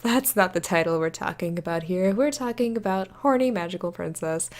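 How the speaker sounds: ordinary delivery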